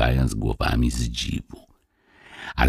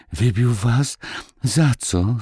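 frightened; 9 semitones higher, slower than usual. A narrator's reading. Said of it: "spytała Marysia z przestrachem".

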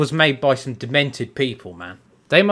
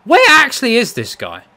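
high, loud, exasperated question; exasperated yell; 7 semitones higher, 5 dB louder than usual. Real, not acted. Gamer hunting his opponent, exasperated yell. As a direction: call it out in real frustration.

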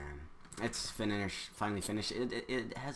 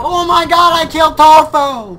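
very high loud victory yell; ecstatic shout; 25 dB louder than usual. Real, not acted. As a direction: scream in triumph. Killed rival in game.

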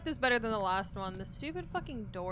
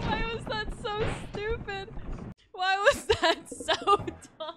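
laughing; 10 semitones higher, 7 dB louder than usual. Real, not acted. Laughing at own panic.

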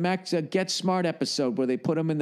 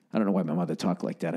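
usual speaking voice

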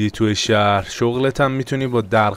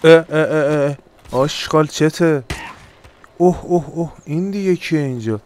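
startled; 5 semitones higher than usual. Pretend. Startled by enemy attack.

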